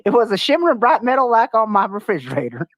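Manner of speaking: quoted witness voice. Retold or imitated. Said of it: "she was quoted in saying".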